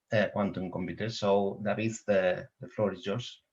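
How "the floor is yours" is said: ordinary talk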